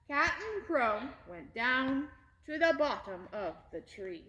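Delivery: ordinary delivery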